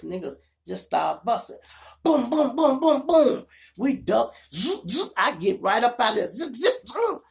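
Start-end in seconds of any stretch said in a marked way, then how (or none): 2.0-3.5 loud high booming gunshot sounds; imitated gunshots. To his listeners, a loud high gunshot imitation. 3.8-7.2 high, loud racing retelling; excited getaway. Retold or imitated. To his listeners, a high loud getaway retelling. Imitating cars speeding off.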